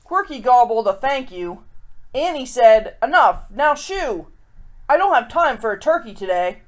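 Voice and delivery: lively rhyming read-aloud; storybook reading. A narrator's reading. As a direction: read it like a picture book to kids. Reads a rhyming picture book.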